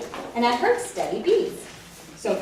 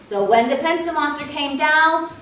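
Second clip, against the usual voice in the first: ordinary delivery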